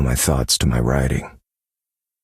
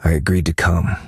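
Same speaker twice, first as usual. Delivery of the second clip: ordinary talk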